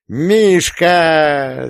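high, loud joyful scream; wild yell. A narrator's reading. Sister leaps on her brother with a wild yell. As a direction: pounce on him with a wild whoop. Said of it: "с диким воплем".

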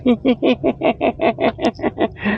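long, high, quiet chuckle; high giggling laugh. Real, not acted. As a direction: giggle away at your own silly jingle. Laughs at his own jingle.

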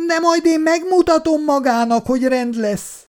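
high-pitched threatening neighbour woman; angry neighbour. A narrator's reading. Neighbour woman threatens Szabó bácsi, high and angry.

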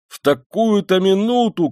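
loud, high-pitched outcry; indignant shout. A narrator's reading. Razumikhin as his friend leaves, shouting. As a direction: cry out in dismay, voice leaping up. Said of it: "Крикнул Разумихин".

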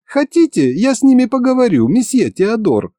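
very high, sweet-talking female character voice; smiling Masha. A narrator's reading. Masha offers Monsieur Theodor help, high smiling voice. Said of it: "улыбнулась Маша".

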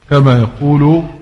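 ordinary delivery

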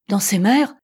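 loud, urgent question; heart pounding. A narrator's reading. Captain questions the sick man, heart pounding. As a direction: ask it eagerly, with force. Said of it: "le cœur palpitant".

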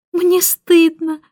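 wife pretending to be ashamed, smiling; smiling false shame. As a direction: confess it while beaming. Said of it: "счастливо улыбаясь".

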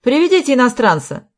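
shouted order. A narrator's reading. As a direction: call an order into the next room. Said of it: "крикнул он в соседнюю комнату". (громкий приказ в соседнюю комнату)

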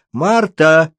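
a woman's calling shout, mildly voiced. A narrator's reading. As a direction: call sharply after the girl. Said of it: "крикнула женщина".

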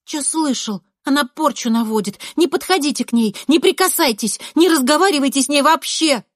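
high, loud, insistent; alarmed schoolgirl warning. A narrator's reading. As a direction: warn them, rising in urgency. Classmate shouting warning at boys. (настойчиво предупреждает, высоким голосом)

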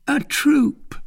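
very high, loud, terse servant; Grimaud's character voice. Acted. Grimaud reports to his master, very high terse voice. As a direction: report in a strange high-pitched voice.

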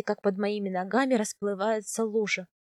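ordinary delivery

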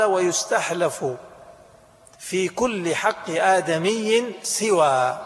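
drawn out, word by word; slow text reading. Shown as a demonstration. Teacher reciting the book's text to students, slow and deliberate. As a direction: read the legal text slowly to the class.

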